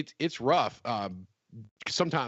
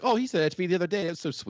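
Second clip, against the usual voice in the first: clueless flattered gush; 6 semitones higher, faster than usual. Retold or imitated. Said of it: "And they're like".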